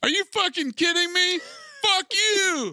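shrieking high pitched outrage; high mock outrage. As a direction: yell it in a cartoonish squeal. Voicing drunk guy angry.

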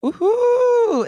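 high-pitched woohoo; excited cheer. Real, not acted. Guest welcomed to the podcast, excited cheer. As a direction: whoop it out with delight.

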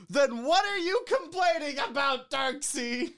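high, loud mock outrage; mock indignant shout. Mock-scolding the reviewer.

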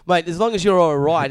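ordinary talk

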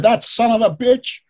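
high, slow, outraged snarl; angry rant. Retold or imitated. Biographer retelling the old man's phone rant, outraged. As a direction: spit it out like a furious old man on the phone. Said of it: "He goes off on this rant".

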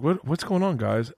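mock wife voice. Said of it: "my wife's like".

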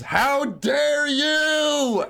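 long, high outraged yell. Acted. Player yells an enraged knight's protest at the skull insult, outraged yell. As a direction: draw it out high in a shout. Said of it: "Sir Mister yells".

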